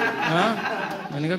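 ordinary delivery

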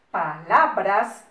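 loud, syllable-by-syllable word; slow dictation. Shown as a demonstration. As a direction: stretch it out for pupils to copy. Teacher dictating definition slowly. (dictado lento y fuerte)

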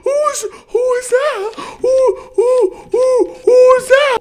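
high, loud panicked yelling; real scared shout. Real, not acted. Streamer startled by a figure in the game, panicked high shout. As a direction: shout in genuine fright, stumbling over words.

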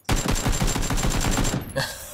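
long unvoiced laugh; real laugh of triumph; gloating laugh. Real, not acted. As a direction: laugh over a successful ambush. Gloats after his planned ambush.

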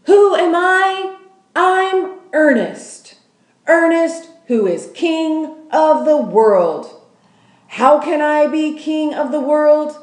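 high, loud, slow crowing shout; shouting king. A narrator's reading. Trash-heap man shouting to the world, loud and high. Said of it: "He shouted".

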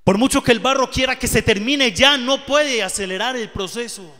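loud, high climax of the sermon; fervent preaching. Acted. A preacher crying out to the congregation about the clay. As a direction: build to a shout about the clay.

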